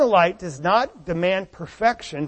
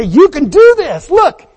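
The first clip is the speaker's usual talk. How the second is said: encouraging parent; 6 semitones higher, 8 dB louder than usual. Retold or imitated. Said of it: "and say".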